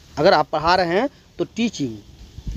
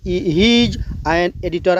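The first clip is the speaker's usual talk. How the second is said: very slow, every word spaced out; slow classroom demo; slow model sentence; much slower than usual. Shown as a demonstration. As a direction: spell it out slowly like a teacher. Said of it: "ऐसे बोलना है".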